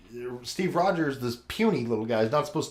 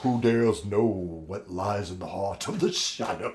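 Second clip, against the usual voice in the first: low, slow, hammed-up doom voice; a pretend horror-trailer narrator; mock ominous narrator; 5 semitones lower, 3 dB quieter, slower than usual. Spooky mock movie-trailer intro.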